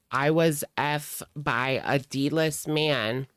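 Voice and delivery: ordinary delivery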